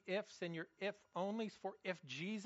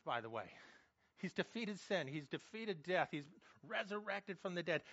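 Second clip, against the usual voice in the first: ordinary talk, nothing marked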